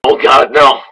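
high, loud panicked shout; terrified cry. Acted. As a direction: scream for your life. Victim discovers the killer.

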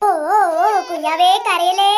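low, slow cooing; soothing a baby. Acted. Doctor to crying newborn, soothing coo. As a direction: hush a crying newborn gently.

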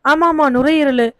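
ஆமா, ஆமா, நுரையீரலு said slowly, nodding to himself with a falling ஆமா; recalling it. Acted. Grieving father to Muthumani, slowly confirming நுரையீரலு. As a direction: settle on நுரையீரலு with slow, sure recognition.

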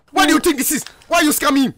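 loud, angry demand; angry shouting. Acted. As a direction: yell at the scammer. Yelling at scammer in game.

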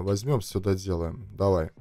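ordinary talk, nothing marked

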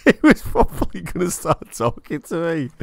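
a hint of put-on American accent after cracking himself up; mock American accent. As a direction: keep the silly Yank voice going while giggling. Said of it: "an American accent right there".

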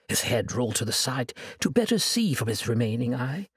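ordinary delivery

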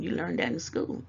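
usual speaking voice

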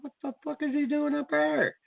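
high-pitched mock shock at the helicopter; alarmed disbelief. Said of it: "And I'm like".

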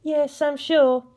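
playful, sly tease; impish. A narrator's reading. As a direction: tease him slowly. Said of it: "she added impishly".

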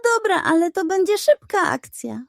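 cocky cat. A narrator's reading. Cat boasting to the pug, lightly voiced and smug. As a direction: brag to the pug like a know-it-all.

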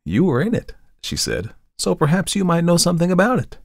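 put-on a touch of a worried young woman; Tessie, young model. A narrator's reading.

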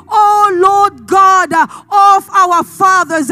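loud, slow prayer call; congregational invocation. Shown as a demonstration. Preacher leading the congregation to say it with him, loud fervent invocation. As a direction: proclaim it in long, drawn-out words. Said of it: "If you can say with me".

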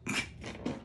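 ordinary talk, nothing marked

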